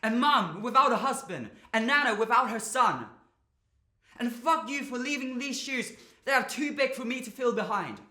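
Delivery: loud, high-pitched raging; anguished yelling, punching each phrase; furious, grieving outburst. Acted. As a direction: shout your hurt at someone leaving you. Rages at his dying father.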